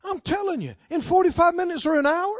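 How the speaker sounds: loud, rising pulpit preaching; preaching at full force. Acted. Preacher urging the congregation, loud and rising. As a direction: drive it home to the congregation.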